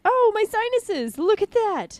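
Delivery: high, slow mock dramatics; hammed-up wail about sinuses. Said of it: "I'm like".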